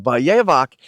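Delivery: slow, careful "vayevak", va-ye-VAK; Hebrew word, slowly. Shown as a demonstration. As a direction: draw out "vayevak" syllable by syllable. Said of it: "I can't get it in my throat right".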